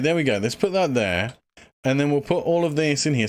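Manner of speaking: ordinary talk, nothing marked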